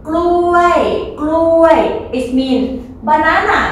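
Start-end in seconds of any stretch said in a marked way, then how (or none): 0.1-1.8 กล้วย กล้วย, each starting high and falling. Shown as a demonstration. Teacher repeating the falling tone for the class. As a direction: model กล้วย twice with a clear falling tone. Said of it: "falling tone".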